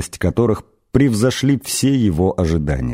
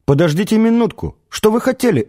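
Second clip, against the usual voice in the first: urgent, much higher-pitched pleading calls; crying out after them; 8 semitones higher than usual. A narrator's reading. Arthur stumbling after the departing ship, urgent high cries. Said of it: "вскрикнул Артур и побежал".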